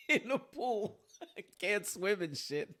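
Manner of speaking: high, loud, laughing through it; laughing at himself. Real, not acted. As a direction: crack up at your own flailing.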